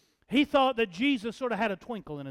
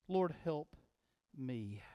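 very soft, low prayer, pausing before the last word; hushed plea; 8 semitones lower, 12 dB quieter than usual. Retold or imitated. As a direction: let the plea fade to almost nothing. Repeating the mother's prayer.

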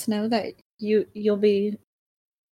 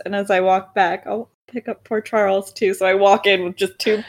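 normal talking voice

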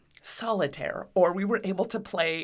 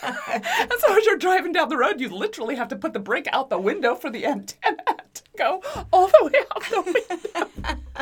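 real laughter breaking into higher, louder speech; laughing while talking; 8 semitones higher, 6 dB louder than usual. Real, not acted. Telling a co-host about driving with a car phone, laughing. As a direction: tell it through mounting laughter.

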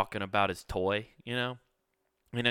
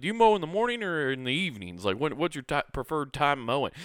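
quizzing neighbor; 6 semitones higher, 4 dB louder than usual. Retold or imitated. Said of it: "he's like".

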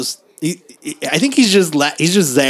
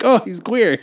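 mock Eddie; 8 semitones higher than usual. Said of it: "he's like".

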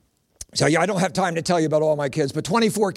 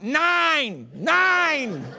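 high, drawn-out mock holler; retold yell; 8 semitones higher than usual. Comic interrupting a mom's count from the next aisle, mock yelling. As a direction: call it out high, as over a store aisle. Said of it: "yelled over top".

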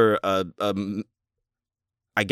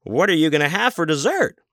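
put-on higher sweet question to a kid; mock server voice; 8 semitones higher than usual. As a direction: ask it like an overeager server. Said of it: "He's like".

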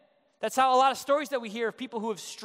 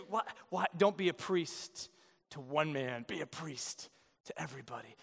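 softer, lower commercial narrator; mock ad voiceover; 7 semitones lower, 7 dB quieter than usual. Preacher spoofing a Nike commercial, low hushed voiceover. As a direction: sell it like a sports ad. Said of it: "fit perfectly in that new Nike commercial".

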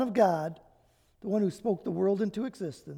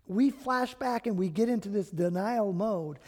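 ordinary delivery

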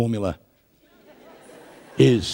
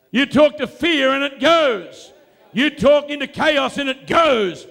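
full-force preaching; 12 semitones higher than usual. Acted. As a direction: drive each phrase home to the congregation.

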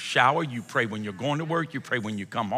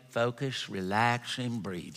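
the coach whispering breathing cues to him; soft Texas coach; 2 semitones lower, 6 dB quieter, slower than usual. Retold or imitated. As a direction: coo it like a big-haired Texas coach. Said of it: "this is how she sounded".

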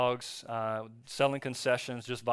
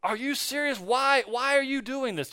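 father scolding his son over the sin list, outraged and high; angry dad; 11 semitones higher than usual. Retold or imitated. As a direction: imitate a furious, condemning father. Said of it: "his dad got really mad at him".

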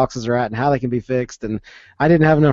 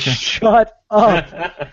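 higher, louder mock snap at a friend; retold angry shut up; 7 semitones higher, 4 dB louder than usual. Scott telling Jake to stop spoiling the game, mock angry snap. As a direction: cut him off, exasperated.

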